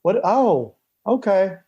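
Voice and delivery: lightly voiced fan who has no idea who he is; disappointed fan. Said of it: "Nobody wants".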